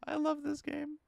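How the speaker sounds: very high excited voice; delighted squeal. Real, not acted. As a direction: gush with delight. Player delighted at the twist.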